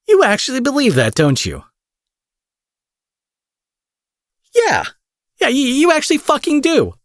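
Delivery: high, loud incredulous disbelief; incredulous mocking. Villain mocks hero's belief.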